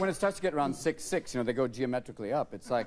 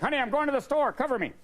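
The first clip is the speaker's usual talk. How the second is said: mock soldier husband; 11 semitones higher than usual. Armed husband leaving for the store.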